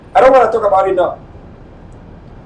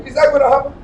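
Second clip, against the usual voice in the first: normal talking voice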